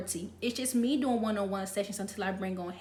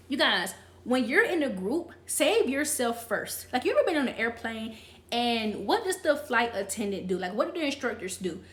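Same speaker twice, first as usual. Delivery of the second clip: ordinary talk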